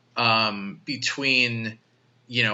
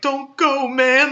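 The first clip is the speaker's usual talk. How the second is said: put-on whiny kid plea; 13 semitones higher, 4 dB louder, slower than usual. Kid begging Cusack to stay.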